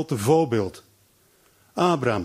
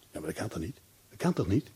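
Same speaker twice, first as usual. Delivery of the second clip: quiet disbelief; 7 semitones lower, 10 dB quieter, faster than usual. Acted. Abraham voiced in disbelief.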